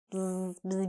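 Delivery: soft buzzing bee noise; imitated bee buzz. Teacher playing on the word busy, softly buzzing.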